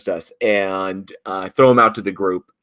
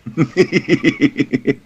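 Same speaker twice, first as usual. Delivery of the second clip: laughing; 7 semitones higher than usual. Real, not acted. Laughs at joke.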